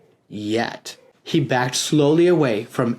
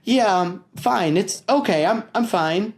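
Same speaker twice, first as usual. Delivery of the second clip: higher, stammering denial; nervous cover-up; 6 semitones higher than usual. Acted. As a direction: fake calm while panicking. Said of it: "he said out loud".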